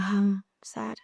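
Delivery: mock-sad whisper. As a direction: sigh it out as a tiny whisper. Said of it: "I'm like".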